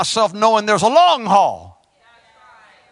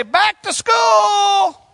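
wake-up call; 7 semitones higher, slower than usual. Acted. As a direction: shout them back to work. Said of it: "ring the bell and say".

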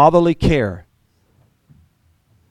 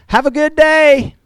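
mock breezy farewell; 14 semitones higher, 4 dB louder, slower than usual. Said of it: "if I just said".

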